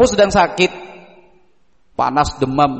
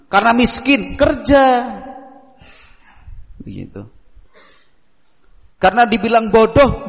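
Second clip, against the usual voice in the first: forceful preaching; 7 semitones higher than usual. Real, not acted. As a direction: drive each remedy home. Firm advice list, preaching.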